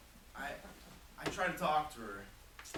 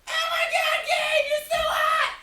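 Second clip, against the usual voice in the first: put-on much higher, loud shrieking girl, slow on "so hot"; squealing fangirl; 16 dB louder than usual. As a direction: scream it like a swooning fan. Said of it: "girls will be like".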